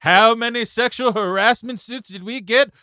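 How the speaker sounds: hype-man shouting. Acted. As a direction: work up the call-center crowd. Acting hype man at company meetup.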